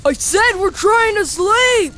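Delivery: loud, high complaint; irritated yell. Acted. Sleeper complaining about noise, irritated yell. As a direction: shout them down crossly.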